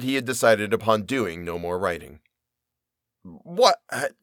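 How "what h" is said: higher, stunned, halting What; a narrator's mock outburst of surprise; astonished exclamation. A narrator's reading. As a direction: sputter out the disbelief. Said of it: "exclaimed I".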